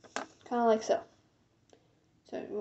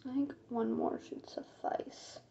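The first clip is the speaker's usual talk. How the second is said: slow, soft whisper; 10 dB quieter, slower than usual. Real, not acted. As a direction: whisper it slowly to yourself.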